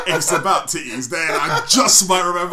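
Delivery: giggling through the words; laughing. Real, not acted. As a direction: laugh while you say it. Laughing while joking.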